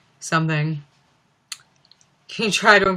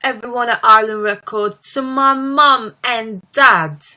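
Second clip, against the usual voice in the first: [name] impression; 4 semitones higher, 3 dB louder than usual. Streamer imitating the singer's Grammy speech, London accent. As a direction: list thanks in an exclaiming London accent. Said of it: "her Grammy speech where she was like".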